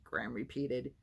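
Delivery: ordinary delivery, nothing marked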